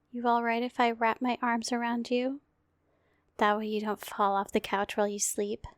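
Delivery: hushed, tender bedtime voice; gentle murmured ASMR comfort. Acted. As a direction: lull a sleepy partner off to sleep. Whole comfort roleplay.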